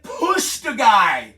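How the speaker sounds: slow, loud exaggerated drawn-out delivery; stretched, loud mock action; mock slow motion. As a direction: shout it out in hammed-up slow motion. Mocking a slow movie fight.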